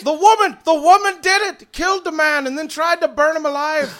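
very high, loud outburst; furious accusation. Acted. Druid accusing trio of burning forest, furious shout. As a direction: shout the charge in outrage. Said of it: "who's been extremely pissed off".

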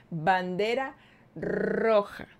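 "Bandera roja" drawn out very slowly, each word weighted; slow emphasis. Real, not acted. She tells her viewers the ex's behaviour was a red flag, said slowly. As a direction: hammer home "Bandera roja".

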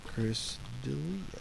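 ordinary talk

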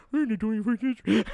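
high, far-off imitation of a friend; mock condescending voice. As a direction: parody his voice, nasal and snooty. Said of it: "Why was that my voice".

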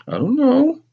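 put-on very high child's whine; whining, almost crying. A narrator's reading. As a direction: whine it as if near tears. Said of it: "I whined again, almost crying".

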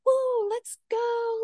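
high, drawn-out instructor cheer; mock hyped-up. Mimicking a cycling instructor firing up the class, mock hype. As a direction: imitate a pumped-up workout cheer, long and high. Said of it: "like, hyped up".

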